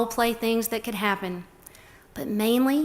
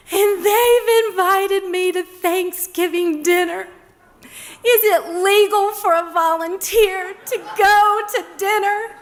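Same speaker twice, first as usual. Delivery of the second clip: tearful volunteer; 9 semitones higher, 6 dB louder, slower than usual. Retold or imitated. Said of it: "she was crying".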